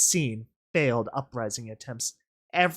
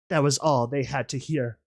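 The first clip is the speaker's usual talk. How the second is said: ordinary delivery, nothing marked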